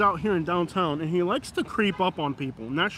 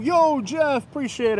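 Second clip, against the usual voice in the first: raised, higher greeting call; 7 semitones higher, slower than usual. Real, not acted. Vlogger thanking Jeff, calling out. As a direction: hail someone with a lifted voice.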